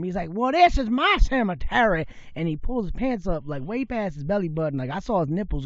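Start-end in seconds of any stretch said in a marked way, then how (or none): 0.3-2.1 raised, loud Southern voice; deep Southern accent. Retold or imitated. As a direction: imitate a territorial Southern local. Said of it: "deep southern accent".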